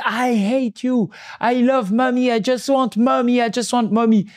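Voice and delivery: put-on high, very slow whining child; imitating a toddler. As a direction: stretch out each word like a sulking toddler. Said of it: "il commence à parler comme ça".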